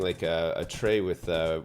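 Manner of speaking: plain reading voice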